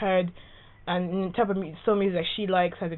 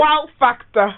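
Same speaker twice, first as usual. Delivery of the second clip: put-on Welsh accent, higher and louder; exaggerated Welsh accent; 7 semitones higher, 6 dB louder, slower than usual. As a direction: ham up a Welsh lilt. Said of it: "I love that Welsh accent".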